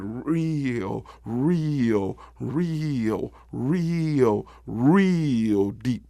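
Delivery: each "real" stretched long; slow, elongated "real" for emphasis; drawn-out repetition. A narrator's reading. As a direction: dwell on every "real", one after another. Narrator stresses depth by repetition.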